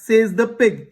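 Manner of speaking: ordinary delivery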